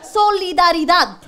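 "Solidaridad" said slow and loud, each syllable weighted; loud, slow "Solidaridad" like a slogan; slow emphatic solidaridad. Acted. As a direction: drag out "Solidaridad" as a rally cry. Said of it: "poco de solidaridad".

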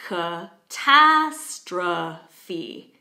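"catastrophe" very slowly, broken into four syllables; loud "tas", weak "uh" in first and third syllables of "catastrophe". Shown as a demonstration. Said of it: "listen to what it does to the vowel sounds".

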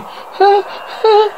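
two short high sobs; sobbing. Acted. Reader plays the woman sobbing, high sobs. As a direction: sob in high little bursts. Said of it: "Lucy sobbing".

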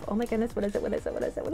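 breathless anticipation; hushed suspense. Real, not acted. Suspense before reveal.

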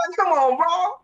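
high-pitched disbelieving plea; real exasperation, voice pitched high; exasperated protest. Real, not acted. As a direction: plead in disbelief, pitch jumping up. Exasperated at the film casting.

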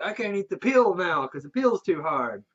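the rude customer griping about the peel; whiny complainer. Retold or imitated. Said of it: "and he's like".

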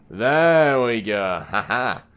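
long drawn-out, loud cheer; slow, gloating celebration; triumphant. Real, not acted. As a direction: stretch it out in victory. Succeeds after struggle.

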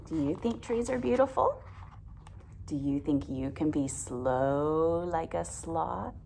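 ordinary delivery

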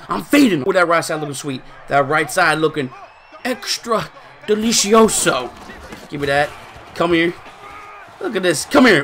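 ordinary delivery

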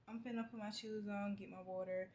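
ordinary delivery

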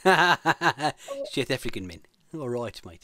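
put-on slow, laughing South African accent; deliberately bad South African accent. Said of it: "in a really bad South African accent".

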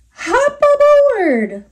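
high, loud and slow with a laugh; a jolly, laughing invitation. Acted. Bug laughing as he invites the spider aboard, high and loud. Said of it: "laughed the bug".

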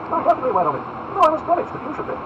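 high, loud lordly voice; ringing, high-pitched aristocrat; haughty Duke, unruffled. Acted. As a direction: answer loftily as a self-assured Duke.

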